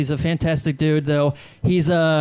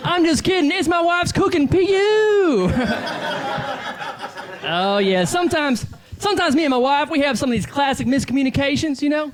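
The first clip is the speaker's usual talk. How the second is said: hack club comic; 10 semitones higher than usual. Acted. Comic in character working the crowd, brash high shouting voice.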